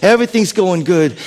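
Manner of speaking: normal talking voice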